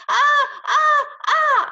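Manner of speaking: high, loud screams in bursts; piercingly high short screams; scariest scream. A narrator's reading. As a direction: scream to make someone jump. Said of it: "I did my scariest scream".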